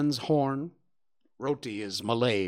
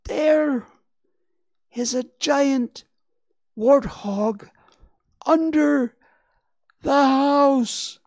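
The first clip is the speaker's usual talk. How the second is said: high, slow squeaked words, one at a time; strangled voice; 13 semitones higher, 8 dB louder, slower than usual. Acted. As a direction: squeak out each word in terror. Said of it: "Very slowly, picking her words one at a time, she managed to squeak out".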